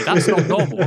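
laughing through the words; can't help laughing mid-joke. Real, not acted. Joking with guest about weather.

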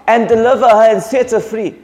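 pleading cry to Jesus, pitched high. Acted. Woman begging Jesus for her daughter, high pleading cry. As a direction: cry out to the Master for help. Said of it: "she cries out".

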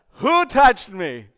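sudden called-out question. Acted. Jesus stopping the woman as she sneaks off, loud call. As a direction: call it out slowly over a crowd.

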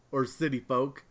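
normal talking voice

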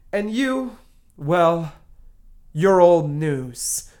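usual speaking voice

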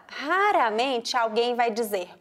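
ordinary delivery

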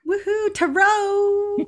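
high, slow woohoo; playful hammed-up cheer; mock cheer. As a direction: cheer it like a party. Cheering the episode topic.

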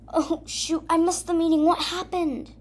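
high, alarmed realization; startled dismay. Acted. Narrator realizing she missed the meeting, alarmed. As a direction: gasp it out as the miss sinks in.

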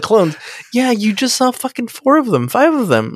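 high-pitched, incredulous mock retort; Riker. Podcaster acts out a crewman baffled by clone talk, high incredulous reply. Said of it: "Even Riker's like".

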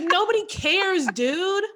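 high-pitched mock exasperation; exasperated retort. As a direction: dismiss a braggart, laughing it off. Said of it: "And I was like".

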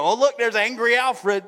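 playful mocking aside; mocking gossip. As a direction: point him out with a sneer. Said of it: "and you go".

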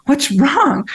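high, concerned husband question; a husband alarmed by sudden tears; lightly voiced worried husband.

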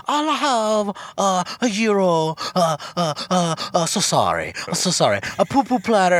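over-polite dad ordering in broken English; dad's restaurant voice. Retold or imitated. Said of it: "at a Greek restaurant and be like".

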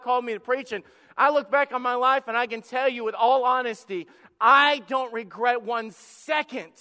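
loud, emphatic testimony; preaching at full force. Real, not acted. Pastor testifies to the congregation, loud and emphatic. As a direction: declare it to the whole church.

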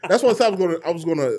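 talking through laughter; laughing while talking. Real, not acted.

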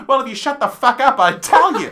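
gruff shout. Retold or imitated. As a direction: bark it out rudely, loud. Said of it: "Starsbarsky is like".

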